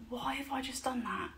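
1 whispered self-question. Retold or imitated.

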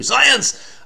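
high-pitched comic proclamation; mock-triumphant exclamation. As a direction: proclaim it playfully, voice jumping up. Playful exclamation after a fact.

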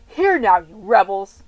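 loud, furious shout at the crowd; angry rebuke. Acted. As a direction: blast it at the rebels. Moses scolds the assembly.

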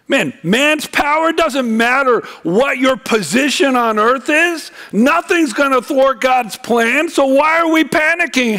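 high, driving sermon delivery; impassioned preaching. Acted. Preacher urging congregation not to fear man's power, impassioned.

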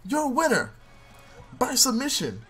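high, hyped ringside play-by-play; announcer call. Acted. Player announcing his own win like a ring announcer. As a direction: call the finish like a wrestling announcer.

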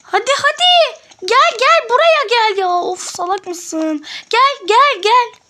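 high, loud frustrated yell. Real, not acted. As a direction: yell at a slow teammate. Yelling at teammate to come.

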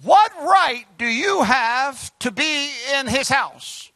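each word drawn out slowly; slow, measured rebuke; slow, weighty translation. Shown as a demonstration. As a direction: deliver the paraphrase slowly, like a verdict.

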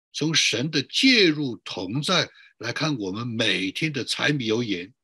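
ordinary talk

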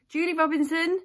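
high, loud call of a name; shouting out. Retold or imitated. Dentist to the waiting room for the next patient, shouting out. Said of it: "I shout out".

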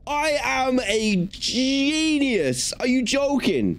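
drawn-out high squeal of self-praise; thrilled with yourself, high and slow; triumphant. Real, not acted. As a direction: crow it like you just cracked the code. Proud after solving the puzzle.

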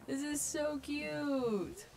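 high, drawn-out coo; gushing. Real, not acted. Gamer adoring a friend's island, gushing.

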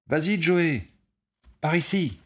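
high, loud call across the field; calling for the ball. A narrator's reading. Ball player calling Joey across the field, loud call. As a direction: shout for the pass.

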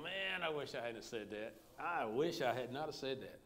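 low, hushed note of regret; imitating quiet remorse; miserable regret. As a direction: mutter it softly like a private regret. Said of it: "it's just miserable".

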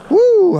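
very high, loud whoop of triumph; ecstatic whoop. Real, not acted. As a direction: shriek a real victory whoop. Big fish in net, whoops.

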